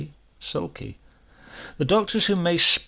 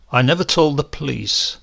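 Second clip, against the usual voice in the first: usual speaking voice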